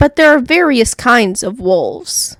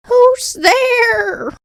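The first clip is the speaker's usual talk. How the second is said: put-on old grandmother calling; 11 semitones higher than usual. A narrator's reading. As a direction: call out from bed like a frail granny. Grandmother calls from bed.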